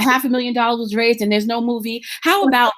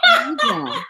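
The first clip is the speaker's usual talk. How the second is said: mock squeal. Mocking the fraud seller.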